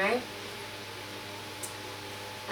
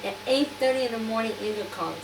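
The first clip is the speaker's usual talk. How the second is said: ordinary delivery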